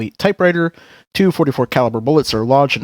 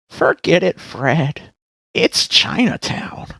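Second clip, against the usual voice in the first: put-on old lady voice; 4 semitones higher, much slower than usual. Old lady line in scene.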